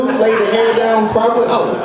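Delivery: normal talking voice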